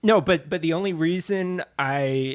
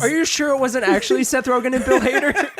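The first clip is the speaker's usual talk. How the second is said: laughing; 9 semitones higher than usual. Real, not acted. Listener jokes about McLovin.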